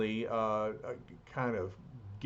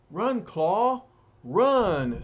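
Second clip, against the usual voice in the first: loud, high cry to run; urgent shout; 7 semitones higher, 8 dB louder, much slower than usual. Acted. Soldier shouting at Claw to run, loud urgent yell. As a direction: yell at him to flee now. Soldier urging villain to flee.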